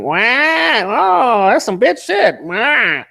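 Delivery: nasal-free but high mocking whine of a rival; penguin voice mockery. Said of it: "You penguin voice having".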